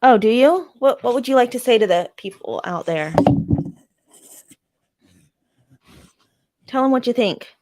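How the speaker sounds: high, bright pet voice; talking to a dog. Real, not acted. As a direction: coax the dog to speak.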